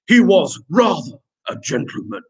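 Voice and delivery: put-on gentleman voice. Teacher hamming up a pupil's sentence, gentleman voice. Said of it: "do that in a gentleman voice".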